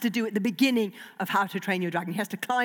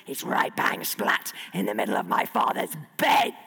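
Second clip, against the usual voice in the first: mock scolding shout; 13 semitones lower than usual. Hiccup scolding the dragon.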